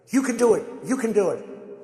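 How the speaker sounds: a hint of a coach shouting encouragement; mock corner coach. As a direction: pump her up like a cornerman. Corner urging boxer back out.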